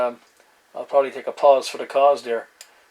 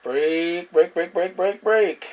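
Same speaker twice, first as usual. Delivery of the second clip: rising repeated break calls, getting higher; radio break call; 4 semitones higher, much slower than usual. Acted. CB operator breaking into the channel, rising call. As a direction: cut in on the channel, climbing each time.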